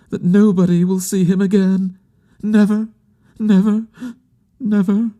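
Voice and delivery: high, grief-stricken woman's lament; grieving woman. Acted. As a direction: play her refusing to accept the loss, pitched high.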